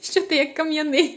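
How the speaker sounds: loud, laughing question; teasing laugh. Real, not acted. Mother teases her son during the video tasting, laughing. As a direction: tease him with real laughter in your voice.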